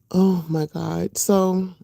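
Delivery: usual speaking voice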